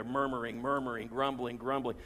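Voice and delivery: low grumbling murmur. Shown as a demonstration. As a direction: mutter the words like the grumblers. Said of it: "It sounds like what they're doing".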